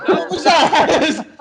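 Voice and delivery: high, slow, laughing question. Real, not acted. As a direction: crack up as you ask it.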